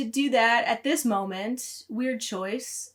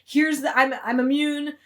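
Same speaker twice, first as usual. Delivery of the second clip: louder and higher, a touch urgent; mock urgent Ellie; 4 semitones higher, 8 dB louder than usual. Ellie pinning Dina to a wall, mock urgent command. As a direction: rush it like a hammed-up order. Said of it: "pins her against a wall and is like".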